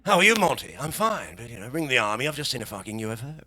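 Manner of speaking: lightly done plummy English gent; a hint of posh English, unbothered; posh English accent. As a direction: play a posh Wiltshire toff calmly. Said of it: "Eccentric part of England".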